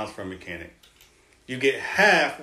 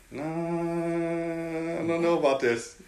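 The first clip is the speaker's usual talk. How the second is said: doubtful drawl; 5 semitones higher, much slower than usual. Retold or imitated. Doubting his contract, retold.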